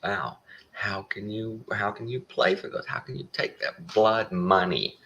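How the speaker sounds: slow, whiny mock indignation; a hammed-up pious lament; sanctimonious whine, slowed down; mock outrage. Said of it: "And they're crying".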